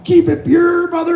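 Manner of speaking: retold pumped-up encouragement; enthusiastic quote. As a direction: cheer them on, keen and upbeat. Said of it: "'cause I'm all".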